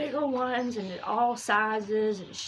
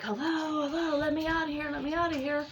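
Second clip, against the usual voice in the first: calling for help; 7 semitones higher than usual. Retold or imitated. Trapped teller calling to whoever is outside, high plea for help. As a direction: call out high to be let go, without shouting.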